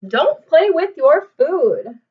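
loud, slow, deliberate scold; stern mom. Acted. Mom scolding David at dinner. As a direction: lay down the rule firmly.

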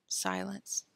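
plain reading voice

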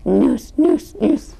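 a touch of a happy piggy grunt; lightly voiced pig grunts. A narrator's reading. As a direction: grunt it out contentedly. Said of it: "grunt happily".

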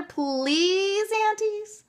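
put-on high, drawn-out child's plea; wheedling, pleading kids; begging. A narrator's reading. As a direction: beg in a high voice, stretching out "please". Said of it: "begged Meg and Sean together".

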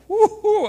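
high, loud "woo-hoo"; mock whoop. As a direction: let out a high playful whoop. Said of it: "I'm like".

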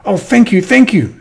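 high, grateful squirrel voice; delighted thanks. Acted. Squirrel thanking the mole for her found acorns, high and delighted. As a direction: gush it high with relief.

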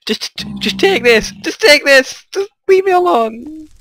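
building from a plea to a high panicked cry; panicking plea. Real, not acted. Begging the attacker to stop.

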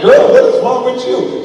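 retold exasperated outburst; exasperated dad. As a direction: call her out, loud and incredulous. Exasperated dad challenging his daughter.

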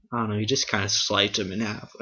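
normal talking voice

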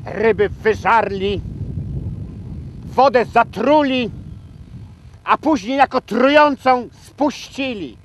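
high, loud denunciation; theatrical outrage at full voice; outraged lament. Acted. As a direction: cry out each charge, climbing in pitch. Rails at communists ruining ponds.

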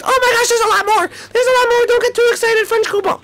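very high, small character voice; squeaky cartoon voice. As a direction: chirp like a tiny cartoon sidekick.

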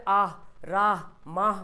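आह, राह, माह with an audible breathed ending; clearly sounded h. Shown as a demonstration. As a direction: push the final h of माह out through the mouth. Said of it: "मेरी आवाज़ से मुंह से निकले". (आह, राह, माह में हा साफ सुनाएँ)

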